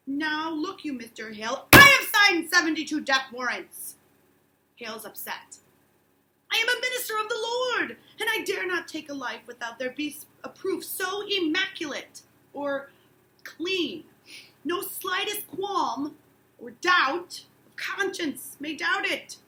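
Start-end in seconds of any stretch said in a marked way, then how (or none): 0.1-4.0 much louder on the death warrants; judge's retort. Acted. Danforth's retort. 6.5-19.4 high, loud, slowing to a shout on qualm; impassioned protest. Acted.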